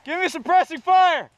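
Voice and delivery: loud, drawn-out yell to the team; cover me shout. Real, not acted. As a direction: bellow the order for cover fire. Calling teammates during firefight.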